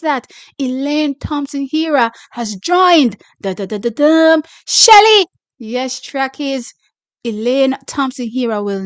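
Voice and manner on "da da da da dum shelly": building drumroll to a high, loud shout; drumroll reveal. Acted. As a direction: tease it, then blast the name.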